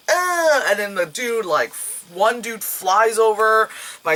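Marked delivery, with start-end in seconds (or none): 0.1-0.6 pained grunt. Retold or imitated. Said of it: "it's just like".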